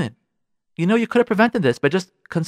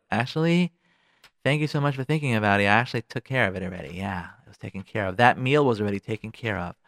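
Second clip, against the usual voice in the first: polite letdown; 6 semitones lower, 5 dB quieter than usual. Acted. Wife lets surprising husband down.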